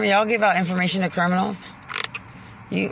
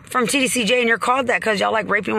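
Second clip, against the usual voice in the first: raised, higher angry voice; heated rant; 6 semitones higher than usual. Real, not acted. Ranting at the sheriff's department and TDC, angry and raised. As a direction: voice climbing as the anger boils over.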